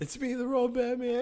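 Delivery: co-host echoing the desperate plea; laughing high mimicry. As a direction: parrot the line with a giggle. Co-host repeats quote.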